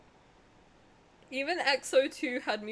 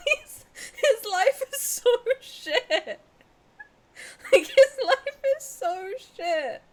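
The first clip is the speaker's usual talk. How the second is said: laughing distress; 4 semitones higher, 7 dB louder than usual. Real, not acted. Distraught over character's fate.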